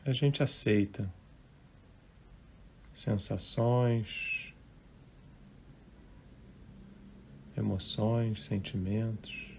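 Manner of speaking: unhurried, hushed relaxation guidance; guided meditation. Real, not acted. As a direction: gently guide listeners into relaxing, phrase by phrase.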